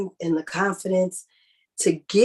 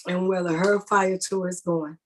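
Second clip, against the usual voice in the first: normal talking voice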